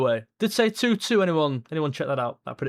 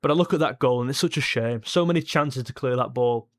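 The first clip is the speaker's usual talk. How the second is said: ordinary talk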